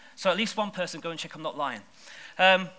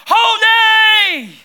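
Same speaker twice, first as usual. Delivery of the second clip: a long, high, loud holy; awestruck holy; 16 dB louder than usual. Retold or imitated. Creatures around the throne crying out in wonder. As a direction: cry it out in awe. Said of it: "Or to put it in biblical language".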